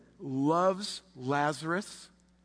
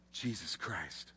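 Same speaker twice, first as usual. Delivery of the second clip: solemn hush; 9 semitones lower, 11 dB quieter, slower than usual. Acted. Solemn close of prayer.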